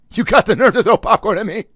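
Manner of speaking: high, loud indignant yell; hammed-up outrage at the popcorn thrower; mock outrage. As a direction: pretend yelling at the man who spilled popcorn. Imagined confrontation.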